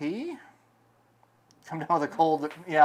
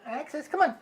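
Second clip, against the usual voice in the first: high coaxing call to a cat; pet talk; 10 semitones higher than usual. Real, not acted. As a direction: call the kitty sweetly. Calling the cat.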